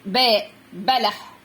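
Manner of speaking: باء، بلح spelled out slowly, each sound drawn apart; slow letter demo. Shown as a demonstration. Teacher sounding out the letter for children, slowly. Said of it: "ده صوت إيه؟".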